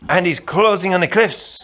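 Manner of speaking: easy, wry reply; lightly voiced Spaniard. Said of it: "added the Spaniard".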